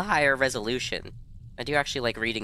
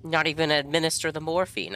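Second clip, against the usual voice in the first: ordinary talk, nothing marked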